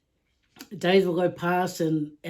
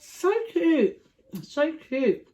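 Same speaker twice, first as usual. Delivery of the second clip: higher, delighted so cute; delighted gushing; 8 semitones higher, much slower than usual. Retold or imitated. Mum gushing over an old couple, delighted and higher. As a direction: gush over how sweet they are.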